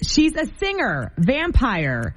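ordinary delivery

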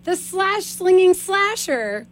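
slow drawn-out slinging slasher; slow dramatic movie-title read; SpongeBob quote. Retold or imitated. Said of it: "that SpongeBob episode".

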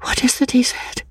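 high, hushed self-questioning; whispering to herself. A narrator's reading. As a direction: puzzle it out in a whisper. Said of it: "whispered to herself".

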